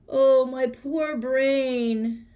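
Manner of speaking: high, slow loud squeal. A narrator's reading. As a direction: squeal it out in dismay. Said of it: "squealed the jackal".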